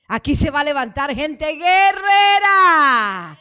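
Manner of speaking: loud, slow, tearful cry; impassioned outcry. Real, not acted. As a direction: cry it out with fervor. Preacher crying out prophecy. (clamor profético con voz quebrada)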